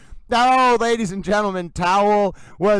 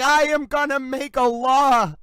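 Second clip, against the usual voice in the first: slow, pitched-up mock decree; grand pronouncement; 5 semitones higher than usual. Telling the chat about Sue, a mock proclamation. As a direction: declare it like a royal proclamation. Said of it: "I was like".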